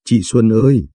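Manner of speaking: a touch of tears calling Chị Xuân ơi; mildly tearful cry of recognition; sobbing lightly. A narrator's reading. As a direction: call out to her sister, understated weeping. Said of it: "Hạ bưng mặt bật khóc nức nở".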